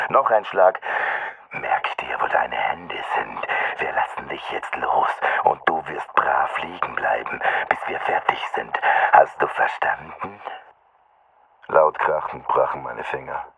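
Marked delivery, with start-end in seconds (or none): 1.5-10.4 menacing whisper. A narrator's reading. Attacker repeats his threat to the pinned boy, menacing whisper. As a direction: lean in and whisper the rules.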